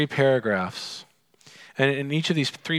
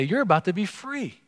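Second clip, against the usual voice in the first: higher, teasing voice of the sons of the prophets; mock them, luring and sly; mocking tempters; 6 semitones higher than usual. Sons of the prophets tempting Elisha, higher and mocking. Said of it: "they're coming out to say".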